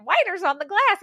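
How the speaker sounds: high, drawled Texas twang on whiners; mock Southern accent. Teasing her co-host by copying her Southern accent. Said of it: "you have a hard time with the Southern accent".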